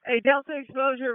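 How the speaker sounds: ordinary talk, nothing marked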